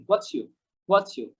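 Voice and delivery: "What's you" run together as wotchu; blended pronunciation. Shown as a demonstration. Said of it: "are you-টাকে আমি কি বলেছি". ("What's you"-কে জুড়ে ওয়াচ্চু)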